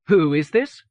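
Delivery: "Who" lightly but unmistakably emphasised; light stress on WHO. A narrator's reading. New editor questioning a caller, "Who" lightly stressed. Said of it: "Her emphasis on the first word was light".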